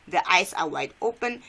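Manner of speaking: normal talking voice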